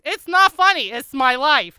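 high, loud exasperated cry; indignant protest. Retold or imitated. Woman objecting to being found funny, indignant. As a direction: protest over the listener's laughter.

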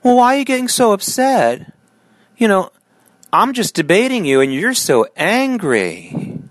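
high, slow, mock-innocent whine; mock innocent opponent. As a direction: sound falsely puzzled, slowing at the end. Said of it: "they answer with the".

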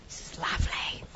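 hushed, whispered appreciation; whispered aside. Real, not acted. Host tasting the wine while guest explains, whispering. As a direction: whisper it under your breath.

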